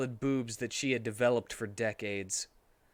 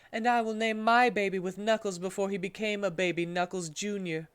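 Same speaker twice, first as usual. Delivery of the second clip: higher, put-on Rouge voice; Rouge character voice; 6 semitones higher than usual. Narrator voicing Rouge to Espio, higher put-on voice. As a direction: voice Rouge pleased with her plan.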